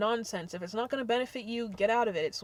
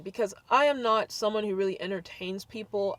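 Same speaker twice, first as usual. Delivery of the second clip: ordinary talk, nothing marked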